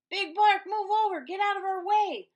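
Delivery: high, loud crowd shout; defiant shout. Acted. Prairie dogs pushing past Big Bark, loud and high. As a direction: shout him aside together.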